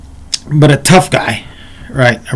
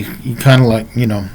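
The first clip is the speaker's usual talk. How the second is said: ordinary delivery, nothing marked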